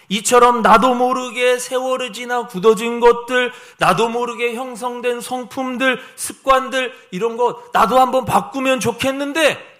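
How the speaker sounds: high, loud sustained sermon delivery; impassioned preaching. Real, not acted. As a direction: press each phrase home to the congregation. Preaches with sudden force.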